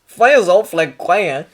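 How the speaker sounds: slow, loud Australian patient; groggy Australian waking up; Australian accent. Acted. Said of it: "He had the funny accent".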